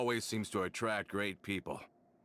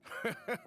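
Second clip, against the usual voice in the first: cheerful laugh. Acted. Returning friend bursts in cheerfully.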